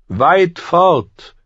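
high, loud, forceful retort; old knight commander. Acted. Grim old Kingsguard commander answering Ned, forcefully defiant. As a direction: throw the answer out high and proud.

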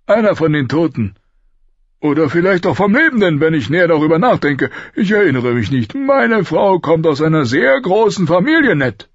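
high-pitched, hearty king holding forth; boisterous King Robert, jovial. A narrator's reading. King explaining his wife's cousins to Ned, boisterous. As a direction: speak up big and high as the king.

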